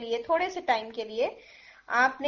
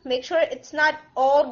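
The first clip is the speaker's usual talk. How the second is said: normal talking voice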